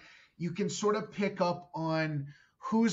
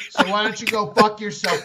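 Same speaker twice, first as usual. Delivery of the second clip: loud, higher, laughing snap; mock angry retort; 4 semitones higher, 11 dB louder than usual. Comic telling off a pushy guest, mock angry. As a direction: fake outrage with a laugh in it. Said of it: "'Cause people used to try".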